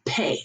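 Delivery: "pay" as French "pé"; short French "pay" for P; French letter P. Shown as a demonstration. Teacher showing French P to class, "pay". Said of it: "the P comes out as".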